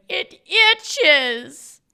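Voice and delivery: loud, high comic wail; mock crying wail. Wall Street guy telling his family what's wrong, mock crying wail. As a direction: wail it out like a tantrum of tears. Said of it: "then he starts crying".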